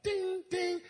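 high sung phone ding; text alert chime. Acted. Preacher mimics Martha's text arriving, high chime. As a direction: imitate a message tone in a high voice. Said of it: "text message noise goes off".

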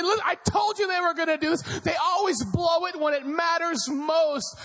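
high, fast angry rant; yelling at mom. Retold or imitated. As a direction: blame her like a sore fan. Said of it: "he's yelling at her saying".

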